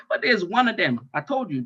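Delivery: ordinary talk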